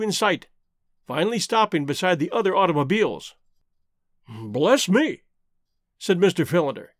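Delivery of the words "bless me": mildly annoyed exclamation; shade of annoyance. A narrator's reading. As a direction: let a touch of irritation show. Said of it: "a shade of annoyance in his tone".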